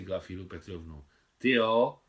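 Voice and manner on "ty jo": a louder, startled really?; the boy's loud surprised exclamation. Acted. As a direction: blurt it out in boyish surprise. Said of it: "podivil se blondětý Vaněk".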